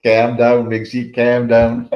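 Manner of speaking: slow, drawn-out Scouse "calm down"; a half-hearted stab at a Scouse accent; mock Scouse accent. Telling a mate on camera in a mock Scouse accent. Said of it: "I couldn't put a Scouse accent on".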